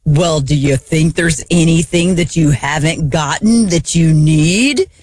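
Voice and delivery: drawn-out mock-innocent question, ending on a stretched NEED; sarcastic. Wife needling husband over all his hiking gear, sarcastic. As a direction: ask it with heavy sarcasm, dragging out the end. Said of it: "I said to him sarcastically".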